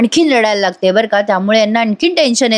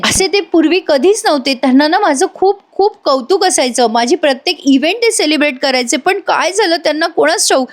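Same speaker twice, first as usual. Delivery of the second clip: higher-pitched, earnest retold protest; imitated heartfelt pleading; 6 semitones higher than usual. Avni earnestly defends her father to the family, acted heartfelt insistence. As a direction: plead for him as an imitated daughter would. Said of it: "Avni अगदी पोटतिडकीने सांगत असते".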